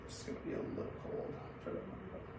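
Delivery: whispered warning about the cold; breathy quiet reassurance; whispered aside. Real, not acted. Cold stethoscope aside to patient.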